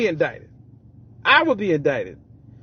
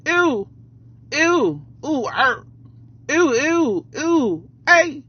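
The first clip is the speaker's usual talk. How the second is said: high yelping ad-libs; [name] impression; 6 semitones higher, much slower than usual. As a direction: yelp the ews up high. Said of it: "my best [name] [name]".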